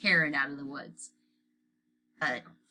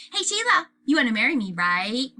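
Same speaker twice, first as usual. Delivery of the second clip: put-on little boy voice; 7 semitones higher, 8 dB louder than usual. A narrator's reading. Fudge calls to Sheila.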